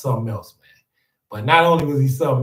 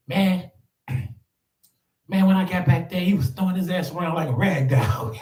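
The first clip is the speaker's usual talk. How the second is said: imitating coworker; 6 semitones higher than usual. [name] retelling saving the old man.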